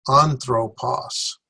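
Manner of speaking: anthropos dit très lentement, syllabe par syllabe; slow syllable-by-syllable. Shown as a demonstration. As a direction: stretch out anthropos, each syllable apart. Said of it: "so they're three syllables".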